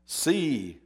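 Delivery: normal talking voice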